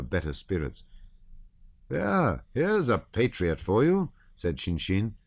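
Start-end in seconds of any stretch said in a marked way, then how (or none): 1.9-4.1 mocking. Shinshin mocking the count.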